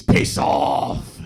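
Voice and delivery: loud mock-angry dad shout; hammed-up bellowed dismissal; knee-jerk dismissal. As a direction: shout it like a dad's automatic reflex. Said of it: "his knee-jerk reaction to everything is".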